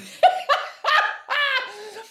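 high burst of laughter; sudden high laugh. Real, not acted. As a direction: laugh at the thought. Laughing at the idea.

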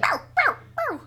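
high-pitched mock yaps; imitated dog barking. Dog barking at the couple hugging, high imitated barks. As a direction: yap like a protective dog. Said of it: "Dog was barking".

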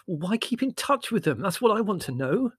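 high-pitched exasperated plea; despairing. A narrator's reading. Larry questioning his mother, despairingly. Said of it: "asked Larry despairingly".

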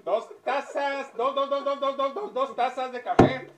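high, loud repeated demanding; insistent order. Acted. Customer loudly ordering two coffees. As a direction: hammer the number two.

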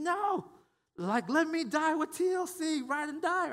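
high, hammed-up yelling plea; mock screaming. Church member screaming at the rescuer, hammed-up high yell. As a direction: squeal it out like a mock martyr refusing rescue. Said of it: "we're just screaming and yelling".

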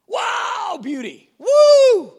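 building to a loud high whoop; over-the-top thrilled admirer; ecstatic Jesus. Acted. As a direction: gush then cheer with delight. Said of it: "do you imagine him going".

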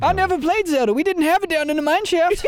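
put-on high old-timer rasp; old prospector. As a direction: talk like a miner from the shaft. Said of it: "You need to use that voice".

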